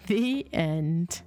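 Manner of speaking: usual speaking voice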